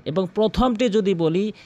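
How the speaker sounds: ordinary delivery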